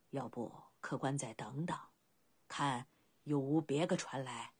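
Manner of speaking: put-on low, halting elderly voice; doubtful old boatman. Old boatman declines the journey. (低沉迟疑的老船夫口吻)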